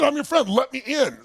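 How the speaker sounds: high-pitched, imploring retold demand; exasperated insistence. Friend at the barely opened door, insistent. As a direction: imitate a friend pressing to be let in.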